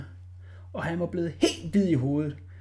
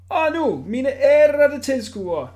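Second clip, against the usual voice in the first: higher, slow showman announcing; grand ringmaster call; circus director; 8 semitones higher, 7 dB louder, slower than usual. A narrator's reading. Director presenting the next trick, showman call.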